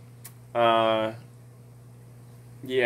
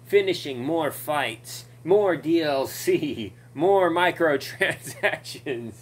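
slow, higher mocking slogan; mocking announcer; 3 semitones higher, 3 dB louder, slower than usual. Mocking the game's money grab, slow and higher. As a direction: drag out each phrase like a sneering ad.

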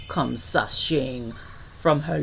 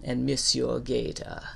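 ordinary delivery, nothing marked

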